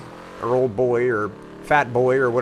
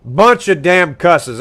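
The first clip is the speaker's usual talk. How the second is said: slow, loud and hammered; slow angry rant; 6 semitones higher, 9 dB louder, much slower than usual. Acted. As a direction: spit out each word in disgust. Rant about beavers.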